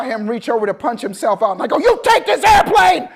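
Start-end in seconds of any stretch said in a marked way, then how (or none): 1.8-3.1 loud, high, slow panicked demand; panicked student pilot begging instructor to take control; desperate yell. Retold or imitated. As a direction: scream it like you're about to crash.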